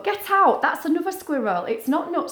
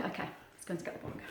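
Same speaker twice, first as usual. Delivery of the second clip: whisper; 6 semitones lower, 17 dB quieter, much faster than usual. Acted. Teacher whispers as puppet.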